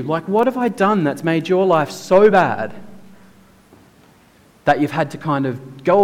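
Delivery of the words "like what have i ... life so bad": ordinary talk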